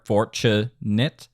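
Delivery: "Fortunate" slowly, FOR on the downbeat; syllable by syllable. Shown as a demonstration. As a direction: spell out the beats of "Fortunate", rising at the end. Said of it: "The downbeat is on four".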